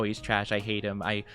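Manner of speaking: normal talking voice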